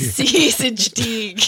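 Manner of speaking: put-on mangled Australian accent. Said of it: "it's so fucking hard".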